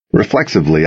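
usual speaking voice

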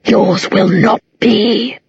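slow, hollow, threatening demon; an ancient demon boasting, slowly; menacing demon voice. Acted. Demon Abaddon speaks.

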